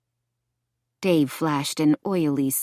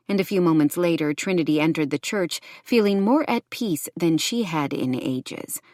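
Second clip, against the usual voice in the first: plain reading voice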